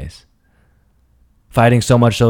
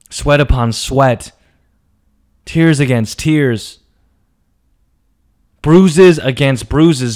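slow, each phrase louder; dramatic build; 4 semitones higher, 5 dB louder, slower than usual. Acted. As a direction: build it up slowly to a loud peak.

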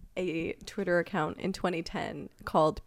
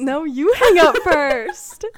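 giggly late night call; 7 semitones higher, 12 dB louder, slower than usual. Pretend. Playful lovers' phone goodbye.

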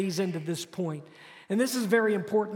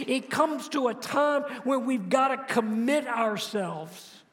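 preaching at full force; 4 semitones higher than usual. Acted. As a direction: drive the congregation to commit. Pastor calls for commitment.

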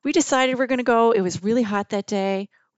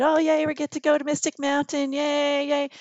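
higher, sing-song mock cheering; really excited; 6 semitones higher than usual. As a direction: cheer like an excited tour group. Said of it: "we're riding all really excited".

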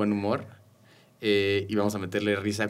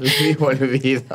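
drawn-out, loud laughing nickname; slow, higher, laughing tease; amused teasing; 4 semitones higher, 4 dB louder, slower than usual. Real, not acted. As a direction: announce the nickname through laughter. Host jokes with nickname, laughing.

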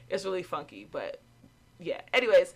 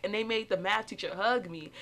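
usual speaking voice

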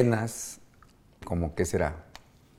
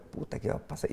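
higher, hushed near-whisper; retold hushed worry; 5 semitones higher, 12 dB quieter than usual. Dad watching from outside the pitch, hushed worry. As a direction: mutter it under the breath.

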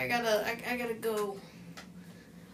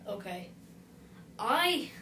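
ordinary talk, nothing marked

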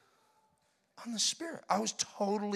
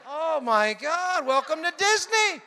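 slow, louder, wide-eyed "welcome to Disney"; mock amazement; 7 semitones higher, 7 dB louder, slower than usual. Pastor recalling his reaction to the laughing family, slow mock awe. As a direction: drag it out in disbelief.

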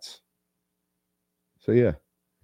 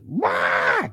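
high piercing imitated baby wail "Wah". Dad imitating his crying daughter, high mock wail. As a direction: mimic a baby's sudden squeal. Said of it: "baby girl just goes irate".

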